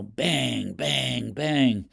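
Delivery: bang, bang, bang, each bang stressed like a beat; mild rhythmic emphasis. Shown as a demonstration. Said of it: "You hear those five bangs".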